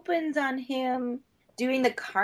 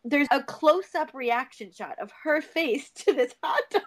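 ordinary delivery

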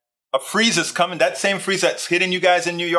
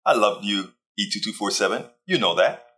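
tender affection; 6 semitones lower than usual. Real, not acted. Tender aside to a regular viewer.

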